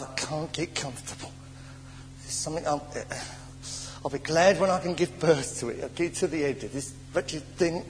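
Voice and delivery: low, subdued weary complaint; uncomfortable pregnant lady. Retold or imitated. Preacher playing a woman nine months pregnant, quiet uncomfortable grumble. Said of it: "See the lady in again".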